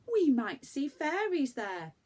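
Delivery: put-on full of wonder like a small girl; dreamy little girl. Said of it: "said Franny".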